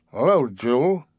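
put-on lightly slurred, heavy drunk greeting; a hint of the drunk's thick tongue; thick drunken voice. A narrator's reading. Drunk man greeting the girl, lightly slurred. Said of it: "he said thickly".

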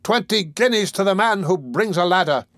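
high, carrying call; cry above the crowd. A narrator's reading. Horseman beneath the window, crying above all others. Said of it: "cried beneath the window in a voice that rose above all others".

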